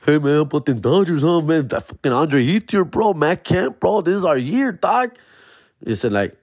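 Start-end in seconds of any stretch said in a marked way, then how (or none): 0.1-5.2 high, eager chatter; hyped fan. Retold or imitated. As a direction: gush about the team. Hyped about Dodgers.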